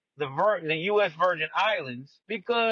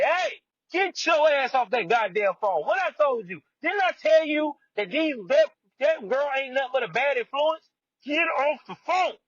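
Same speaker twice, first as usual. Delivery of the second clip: retold angry yelling; 8 semitones higher than usual. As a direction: snap it like an angry man ordering her off the phone. Abusive husband yelling at wife.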